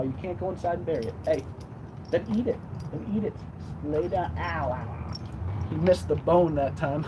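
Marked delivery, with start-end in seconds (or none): none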